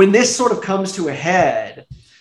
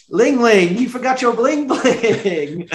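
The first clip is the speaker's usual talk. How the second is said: mocking mom line; 5 semitones higher, slower than usual. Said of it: "when she goes".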